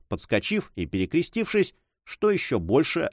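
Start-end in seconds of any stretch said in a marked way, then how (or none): none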